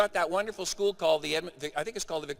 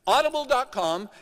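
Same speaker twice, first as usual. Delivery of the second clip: announcing the site; 8 semitones higher, 5 dB louder than usual. Real, not acted. Plugging the website again.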